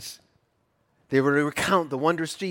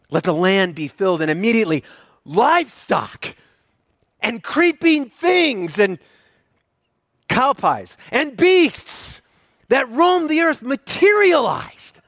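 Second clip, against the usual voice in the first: booming biblical narration; 8 semitones higher, 7 dB louder than usual. Acted. Builds to a shout.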